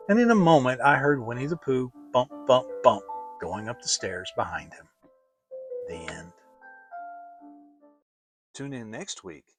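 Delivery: ordinary delivery, nothing marked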